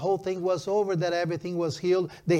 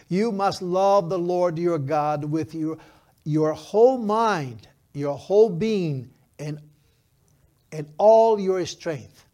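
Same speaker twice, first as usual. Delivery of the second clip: slow, loud, weighty verse reading; scripture declamation; 5 dB louder, slower than usual. Acted. Pastor reading Deuteronomy to the congregation, slow forceful declamation. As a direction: proclaim each phrase with force and pauses.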